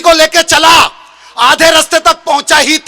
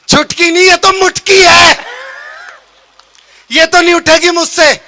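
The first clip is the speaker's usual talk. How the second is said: alarmed protest; 5 semitones higher than usual. Acted. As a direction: object at full voice, stretching the punch word. Groom balks at lifting heavy bride. (घबराकर ज़ोर से विरोध)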